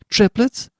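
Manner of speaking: high, loud rising question; father fears the worst; alarmed guess. Acted. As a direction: ask in dread.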